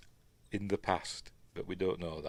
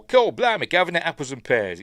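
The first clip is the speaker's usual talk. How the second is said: high, loud Cockney caricature; exaggerated Cockney accent, pitched up; mock Cockney; 7 semitones higher, 7 dB louder than usual. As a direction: belt out hammed-up Cockney patter. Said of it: "You'll hear a Cockney accent".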